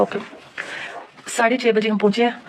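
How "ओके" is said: breathy, barely voiced okay; a defeated little okay under the breath; whispered okay. Giving in to her husband with a whispered okay. Giving in after being scolded.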